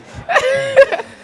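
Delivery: loud, high burst of laughter. Real, not acted. Presenter laughing as the questioner builds up. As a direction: let the laugh ring out high.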